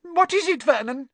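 high and scared; frightened. Acted. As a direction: plead with her husband in fright. Terrified wife at the fireplace.

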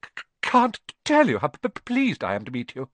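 nervous halting stutter; stammering. Acted. Quirrell meets Harry, anxious stammer. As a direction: stumble nervously.

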